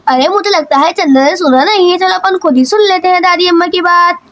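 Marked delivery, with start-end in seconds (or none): none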